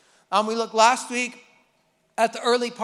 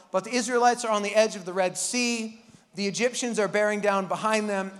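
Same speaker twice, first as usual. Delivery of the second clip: usual speaking voice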